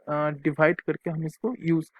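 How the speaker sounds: ordinary talk, nothing marked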